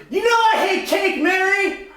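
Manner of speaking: high, loud protest; manufactured conflict. Acted. Improviser starting unnecessary conflict over cake, hammed-up protest. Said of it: "I'm gonna create unnecessary conflict by saying".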